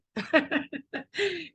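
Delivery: light laugh up high, breathy; a soft embarrassed chuckle; short airy laugh. Real, not acted. Laughs after husband's line.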